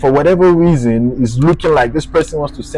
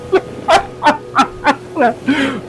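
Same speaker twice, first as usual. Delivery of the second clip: high relieved laughter; 12 semitones higher, 5 dB quieter than usual. Real, not acted. Laughing with relief at getting his passport back.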